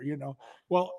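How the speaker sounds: ordinary talk, nothing marked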